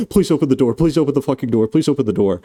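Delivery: high, frantic imitated begging; mock desperate pleading. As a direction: beg urgently, easing down in pitch. Acting Kay begging at the door.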